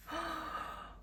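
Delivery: sharp delighted gasp; a quick breathless gasp of awe. Real, not acted. Final reveal of her bread.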